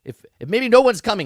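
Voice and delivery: mock upset, high and loud; freaking out. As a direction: imitate a worked-up outburst. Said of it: "He, he just freaks out".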